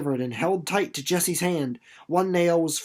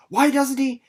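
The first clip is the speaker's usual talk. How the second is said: anxious; 8 semitones higher, 6 dB louder than usual. Acted. Anxious thought while waiting.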